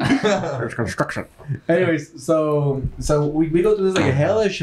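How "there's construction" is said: put-on slow old-timey announcer voice; drawn-out vintage radio voice. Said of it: "Doing old-timey voice".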